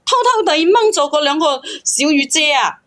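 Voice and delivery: usual speaking voice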